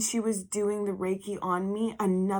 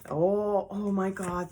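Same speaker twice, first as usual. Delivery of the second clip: ordinary delivery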